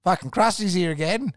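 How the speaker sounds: loud, high sneering call of a regular; mock groan. Mates mocking Krusty's arrival, loud sneering groan. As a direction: groan it out like the locals mocking him.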